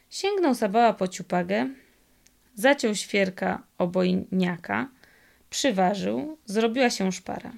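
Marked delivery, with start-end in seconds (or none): none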